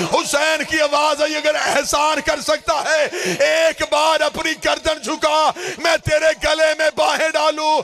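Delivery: full-force lamenting sermon delivery; shouted plea of a grieving father, recited to mourners; impassioned majlis recitation. Acted.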